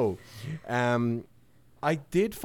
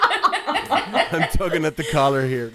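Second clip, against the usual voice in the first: slow, higher, through laughter; laughing discomfort; 6 semitones higher, 4 dB louder than usual. Real, not acted. Podcaster teased about his working wife, laughing uneasily. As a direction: squirm and laugh as you say it.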